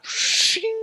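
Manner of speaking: high ringing sword-draw noise; imitated sword shing. Drawing a sword to cut cake, imitated shing. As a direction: voice a blade sliding out.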